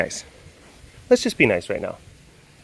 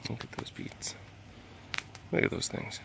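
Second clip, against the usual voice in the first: hushed whisper so as not to disturb; 4 semitones higher, 9 dB quieter than usual. Real, not acted. Filming the animals up close, a hushed whisper. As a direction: breathe it out, barely voiced.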